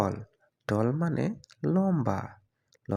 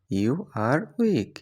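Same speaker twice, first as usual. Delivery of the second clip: slow, word by word for learners; drawn out for students to copy; slow clear repeat; much slower than usual. Shown as a demonstration. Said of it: "example then repeated slowly". (ধীরে স্পষ্ট করে)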